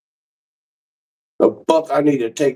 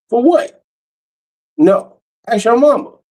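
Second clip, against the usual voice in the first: dismissive dad; 10 semitones higher than usual. Acted. Father waving off his son, high and dismissive. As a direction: toss it off in a high squeaky voice. Father brushing off son's request.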